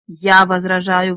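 loud, drawn-out objection; blurted objection. A narrator's reading. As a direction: object firmly, stretching the words. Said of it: "выпалила Леса".

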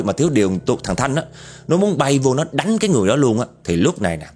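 usual speaking voice

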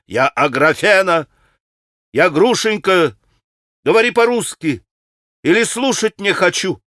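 high-pitched, insistent outburst; angry. A narrator's reading. Grushenka corrects the pan about her name, angry. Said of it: "Она вся покраснела от гнева".

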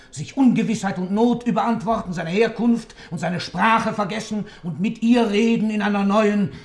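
loud, high indignant tirade; rising outrage. Acted. The old man rails at the judge about the faithless lover. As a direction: let the outrage build and spill over.

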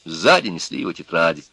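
ordinary delivery

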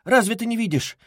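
high-pitched, flustered pleading; scared. A narrator's reading. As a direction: plead anxiously to calm her daughter. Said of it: "перепугалась мать".

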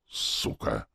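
voiceless, bitter curse under the breath; hissed, low expletive; low muttered curse. A narrator's reading. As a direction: let the swear hiss out after a sigh. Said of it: "Вздохнул и добавил".

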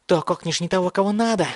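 high crazed voice, rising at the end; mad ranting. A narrator's reading. Mad boy warns archer he has the wrong target, crazed ranting. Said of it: "в голосе явственно звучало безумие".

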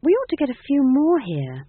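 high-voiced mild sadness; a touch of plaintive regret; sad. A narrator's reading. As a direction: lament it in a high voice. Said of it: "she said sadly".

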